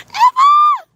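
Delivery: a thrilled shout to a friend arriving; excited shout. Real, not acted. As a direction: squeal her name with delight, high and loud. Greeting a viewer who joined excitedly.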